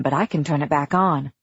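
ordinary delivery, nothing marked

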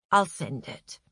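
low, meek surrender. A narrator's reading. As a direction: give in meekly, pitched low. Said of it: "said Alain meekly".

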